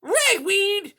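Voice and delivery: high, loud cat outburst; sharp high villain exclamation; interrupting cat. A narrator's reading. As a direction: cut in with a piercing cry. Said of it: "Silversides interrupted".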